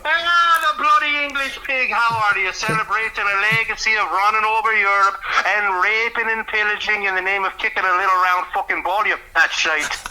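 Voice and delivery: put-on high, loud tirade in a British Isles accent; mock-abusive rant in a thick brogue, pitched high. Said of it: "the Scottish English friend".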